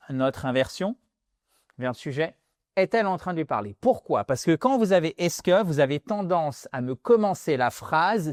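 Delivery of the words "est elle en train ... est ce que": ordinary talk, nothing marked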